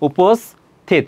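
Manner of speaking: উপস্থিত in two parts, উপস্ then থিত; syllable-split pronunciation. Shown as a demonstration. Teacher showing the spoken spelling, উপস্থিত broken after the s. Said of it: "যেটা উচ্চারণ করছো খণ্ড খণ্ডভাবে".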